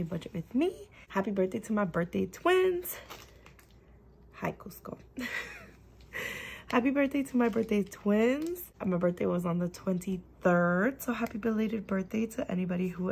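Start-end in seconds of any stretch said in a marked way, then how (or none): none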